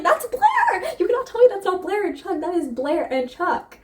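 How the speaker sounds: squealing way up high, thrilled fangirl; excited squeal. Retold or imitated. Fan gushing to viewers about Blair, squealing. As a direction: shriek it like you just spotted your ship. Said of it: "I was like".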